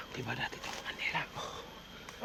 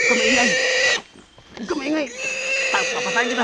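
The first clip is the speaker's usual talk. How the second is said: loud, playful threatening shout; mock threat; 19 dB louder, faster than usual. To a noisy friend, a loud mock threat. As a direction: bark it out loud like a mock gangster.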